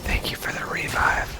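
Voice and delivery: hushed, put-on whispered thanks; exaggerated intimate whisper; teasing whisper. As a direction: whisper it to make a friend crack up. Teasing whispered thanks to make friend laugh.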